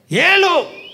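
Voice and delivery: high, loud call; shouted command. Acted. Jesus commanding the dead young man to rise, shouted command.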